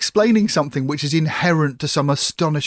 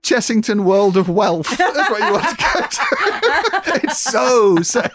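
laughing; 8 semitones higher, 4 dB louder, slower than usual. Real, not acted. Joking about wealthy TV.

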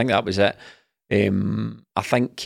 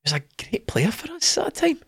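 ironic overstatement; 4 dB quieter than usual. Retold or imitated. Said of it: "That's, that's better".

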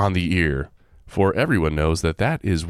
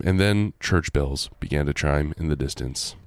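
usual speaking voice

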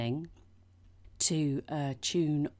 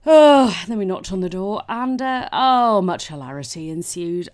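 much louder, higher burst of glee; excited outburst; 5 semitones higher, 16 dB louder than usual. Real, not acted. Recalling the prank, loud and excited. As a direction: blurt it out loud and giddy.

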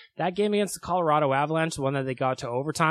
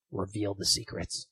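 mock ominous voice; 6 semitones lower, 5 dB quieter than usual.

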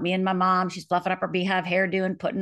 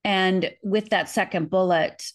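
usual speaking voice

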